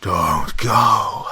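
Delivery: a ghost shrieking as the door slams; ungodly scream. A narrator's reading. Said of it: "she screamed in an ungodly voice".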